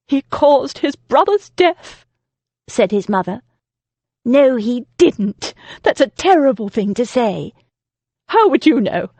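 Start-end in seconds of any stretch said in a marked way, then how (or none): 0.1-2.0 loud slow bitter mother; bitter accusation. A narrator's reading. Mother accuses her son. 4.3-7.5 indignant defence. A narrator's reading. Damaris defends Freddie. 8.3-9.1 sharp retort. A narrator's reading. Mother snaps back.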